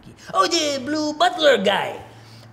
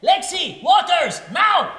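loud impatient call across the office; shouting order; 6 semitones higher, 5 dB louder, slower than usual. Acted. Boss yelling for the intern.

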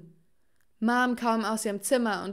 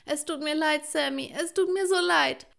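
high, tearful apology; wailing apology; 7 semitones higher, 4 dB louder than usual. A narrator's reading. Mother breaking down before Sammy, wailing apology. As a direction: wail it, rising louder on the repeat. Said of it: "Jetzt heulte sie".

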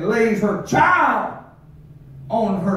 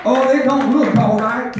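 ordinary talk, nothing marked

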